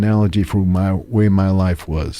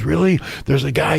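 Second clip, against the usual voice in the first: high, hopeful disbelief; surprised; 9 semitones higher than usual. Retold or imitated. Stranded driver hearing about the garage, high and surprised. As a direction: perk up, hardly believing it. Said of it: "the guy says".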